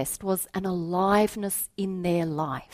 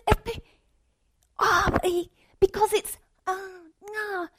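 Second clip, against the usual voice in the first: put-on much higher, louder stammering child; frustrated boy; 5 dB louder than usual. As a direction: stumble over words like a frustrated kid. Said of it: "He was getting so frustrated".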